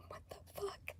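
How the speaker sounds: laughing whisper of disbelief; stunned giggling whisper. Real, not acted. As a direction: breathe it out unvoiced in disbelief.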